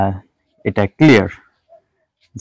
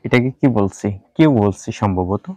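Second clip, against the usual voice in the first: usual speaking voice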